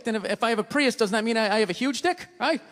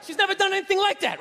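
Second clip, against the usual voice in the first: high, loud, hurried sorry; flustered apology; 11 semitones higher, 3 dB louder than usual. Retold or imitated. Owner apologizing to a passerby, high flustered mock apology. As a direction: apologize fast while holding the dog back. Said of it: "I'm like".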